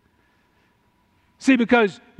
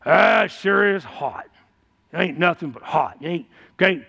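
put-on old timer grumbling; 4 semitones lower than usual. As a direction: drag it out like a griping old man at the store. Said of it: "you run in them old timers at the store".

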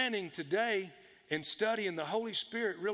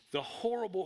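usual speaking voice

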